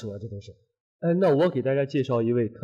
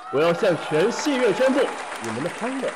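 ordinary talk

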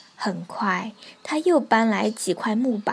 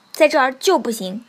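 loud, quick refusal; stern; 2 semitones higher, 8 dB louder, faster than usual. A narrator's reading. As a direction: shut them down firmly. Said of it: "鸭子严厉地说". (严厉地大声拒绝)

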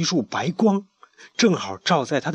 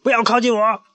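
high, loud stern warning; sharp warning; 11 semitones higher, 4 dB louder than usual. Acted. Chick guarding the scarab, sharp shouted warning. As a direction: warn them off sharply. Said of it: "小胖墩儿厉声警告大家".

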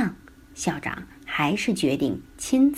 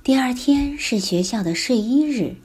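ordinary delivery

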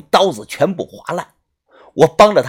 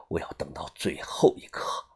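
我要等到最后一刻 whispered, lower and softer; whispered repeat; 13 semitones lower, 12 dB quieter than usual. A narrator's reading. Old Fu repeats his vow to himself, whispering. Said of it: "自言自语的说道".

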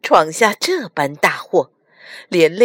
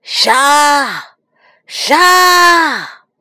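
loud, high, drawn-out cry to kill; gritted-teeth fury; 6 semitones higher, 7 dB louder than usual. A narrator's reading. Evil dragons urge the killing, gnashing their teeth. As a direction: shout it through gritted teeth. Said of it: "咬牙切齿地说".

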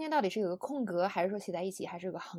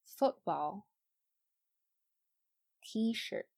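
slow, each compound stressed on its first part; careful teacher's slow readback; slow model for repeating; much slower than usual. Shown as a demonstration. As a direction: read each slowly with a pause for students to echo. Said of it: "大家从头到尾跟我念一下".

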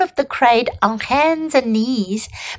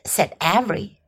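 plain reading voice

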